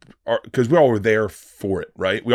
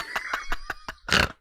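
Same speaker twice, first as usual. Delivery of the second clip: a squealing, very high burst of laughter; real helpless laughter; high-pitched wheezing laugh; 6 dB quieter than usual. Real, not acted. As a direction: lose it completely, laughing. Cracking up at the story.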